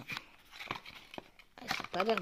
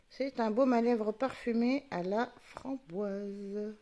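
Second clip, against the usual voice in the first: slow reveal; 3 dB louder, slower than usual. Real, not acted. Drawn-out reveal of the item.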